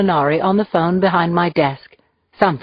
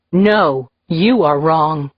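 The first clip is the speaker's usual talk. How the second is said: plain reading voice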